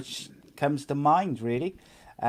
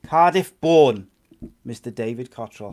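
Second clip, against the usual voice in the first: announcer voice; 5 dB louder than usual. Acted. Host announcing the guest.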